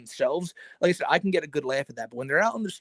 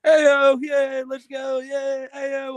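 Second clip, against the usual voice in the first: put-on higher, louder rowdy drunk cheer; drunk party guy; 9 semitones higher, 7 dB louder, slower than usual. The drunk streamer partying, high loud whooping. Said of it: "But when he's drunk".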